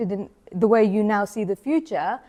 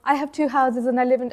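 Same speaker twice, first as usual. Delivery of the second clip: ordinary delivery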